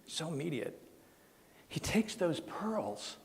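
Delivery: quiet, touched by the story you are telling; moved by memory. Real, not acted. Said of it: "it still gets me".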